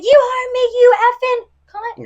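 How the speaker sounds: high, loud mock yell of a furious clerk; retold angry insult. Clerk shouting at her in the store, a high loud mock yell. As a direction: spit the insult back at her. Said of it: "And he said".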